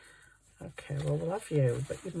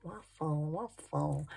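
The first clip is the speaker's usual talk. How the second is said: 1 self-mocking; 7 dB quieter, slower than usual. Real, not acted. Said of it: "What am I on about?".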